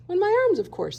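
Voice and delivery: high, brisk monkey answer. A narrator's reading. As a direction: toss it off like a busy monkey.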